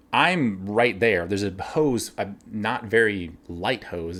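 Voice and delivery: normal talking voice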